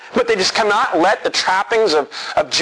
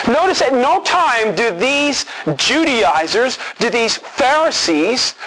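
usual speaking voice

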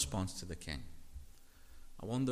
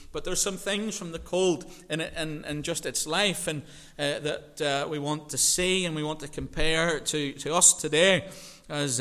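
loud, higher preaching voice; full-force preaching; 6 semitones higher, 9 dB louder than usual. Acted. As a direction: drive the point home from the pulpit.

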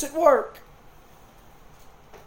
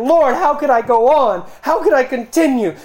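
loud, high cry of despair; anguished plea; 7 semitones higher, 9 dB louder than usual. Acted. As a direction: cry out to the Lord from the pit. Weeping believer voiced crying out.

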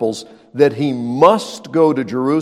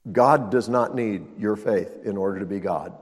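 usual speaking voice

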